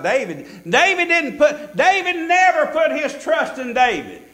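high, loud preacher's cadence; fervent preaching. Real, not acted. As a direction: preach it out with rising fervor. Preaches at full force.

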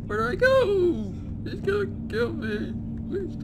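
high frightened cry; panicked. Real, not acted. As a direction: squeal in real fear. Hiding from killer.

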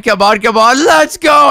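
willing the wheel to land; hurrying someone. Real, not acted. Urging the prize wheel on.

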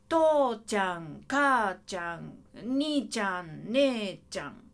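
slow, drawn-out 父ちゃん、母ちゃん with light endings; small final n. Shown as a demonstration. Teacher models 父ちゃん slowly for students, long first syllable, small ん. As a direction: stretch first syllables of 兄ちゃん、姉ちゃん, tuck away ん. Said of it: "小さい音の発音の言葉なので".

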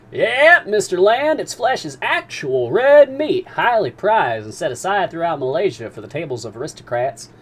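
a captain teasing the harpooner's itch; Captain Nemo. Acted. Captain describing dugong meat.

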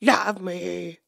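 drawn-out plea, pitch sliding down; mock pleading. Last plea to donkey.

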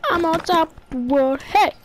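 high, loud triumphant shout; excited cheer. Real, not acted. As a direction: cheer from the rooftop. Cheering on a rooftop.

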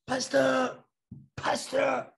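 high calling voice from outside the gate. Retold or imitated. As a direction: call the pastor's name from the gate.

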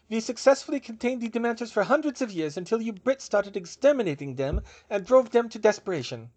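put-on high, forceful German-accented rebuke; thick German accent. A narrator's reading. German witch blaming the Brits, sharp German accent. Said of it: "she said in a thick accent".

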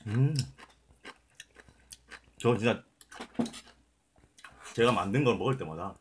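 talking between bites; chewing while talking. Real, not acted. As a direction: mouth full of food. Seen 3: Chewing with bulging cheeks, hand over mouth, food to lips.

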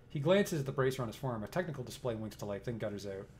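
steady, even storyteller read; game narration. A narrator's reading. Narrator sets the scene for the player, even storytelling. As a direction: read the room description like a narrator.

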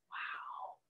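breathless whispered wow; hushed amazement; awed whisper. Acted. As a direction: whisper it in wonder. Seeing a rainbow.

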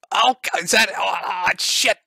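high, breathless excited gasps; excited fanboy voice. Pretend. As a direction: gasp like a smitten viewer. Acting a thirsty donating viewer.

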